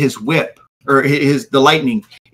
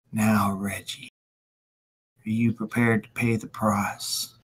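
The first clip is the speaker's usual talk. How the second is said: low, soft menacing threat; menacing villain; 3 semitones lower, 10 dB quieter than usual. Acted. Tally man threatens magistrate.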